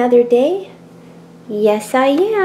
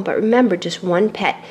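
usual speaking voice

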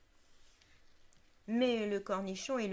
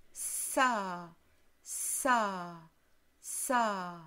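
snake hiss; 3 semitones lower, much slower than usual. Acted. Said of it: "jouer à siffler Monsieur A".

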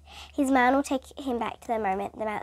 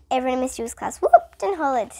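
usual speaking voice

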